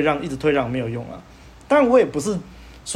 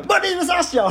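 much higher, louder furious yell; retold furious shout, very high; furious outburst; 4 dB louder than usual. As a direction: burst out the door yelling at the neighbor. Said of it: "我就暴怒".

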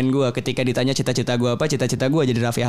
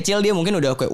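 ordinary talk, nothing marked

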